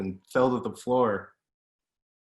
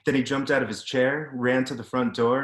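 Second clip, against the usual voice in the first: usual speaking voice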